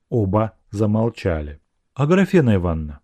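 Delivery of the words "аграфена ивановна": ordinary delivery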